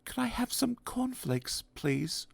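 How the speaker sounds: timid whisper. Acted. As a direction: ask almost inaudibly. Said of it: "whispered Mister Quiet".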